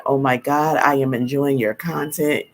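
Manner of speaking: normal talking voice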